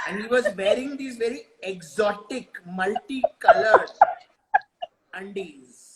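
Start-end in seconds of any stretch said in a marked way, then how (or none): 5.1-5.8 whispered punchline word; hushed reveal. Acted. Storyteller revealing the forgotten zip's secret, hushed. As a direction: drop to a soft whisper.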